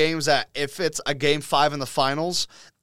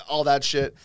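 ordinary delivery

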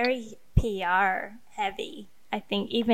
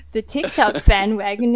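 normal talking voice

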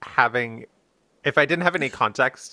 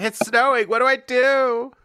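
much higher, pretend-panicked texting voice; mock frantic panic; 12 semitones higher, 4 dB louder than usual. Friend imagining the host panicking at first snow, mock frantic. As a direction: squeal it like a helpless newcomer. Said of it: "frantically text us".

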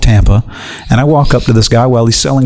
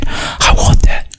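slow, whispered retold plea; hushed yearning; much slower than usual. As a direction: imitate someone moved, whispering it slowly. Moved dealer's plea retold.

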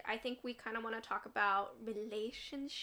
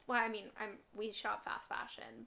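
ordinary delivery

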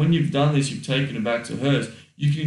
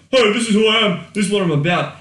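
"Hey, this is who I am" blurted out high and loud; brash announcement; 7 semitones higher, 5 dB louder, faster than usual. Shown as a demonstration. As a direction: shout it like a cocky sales pitch. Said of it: "You don't say that. You don't walk up".

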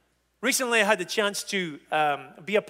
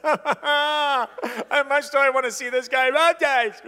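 exasperated inner thought; 8 semitones higher, 4 dB louder, slower than usual. Retold or imitated. Weary pastor's inner thought.

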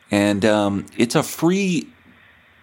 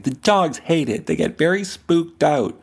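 mocking dog owner; 8 semitones higher than usual. Voicing complaining dog owners.

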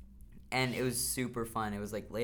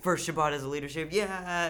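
excited cheer; 5 semitones higher, 5 dB louder than usual. Retold or imitated. Group hype cheer retold.

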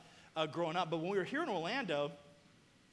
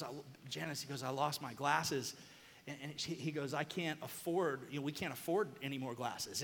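defeated; 5 semitones lower, 5 dB quieter than usual. Retold or imitated. Defeated dad tells mom glasses lost.

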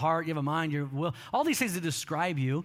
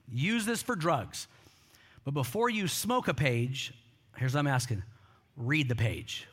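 calm bargaining, with weighty pauses; measured reply; 5 semitones lower than usual. Retold or imitated. Missionary's measured reply to drug lord.

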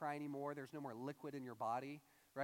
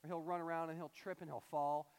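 ordinary talk, nothing marked

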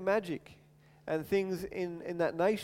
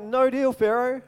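louder and higher, defiant no deal; bold refusal; 5 semitones higher, 6 dB louder than usual. Retold or imitated. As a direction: stand your ground against the king. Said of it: "It's like".

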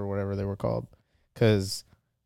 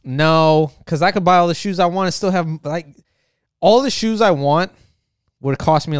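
emphatic rant; 5 semitones higher, 5 dB louder than usual. Real, not acted. Heated rant about money.

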